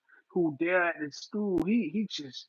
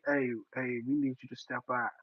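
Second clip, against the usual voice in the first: mock cop voice; 6 semitones lower, 6 dB quieter than usual. Cop voiced in retold story.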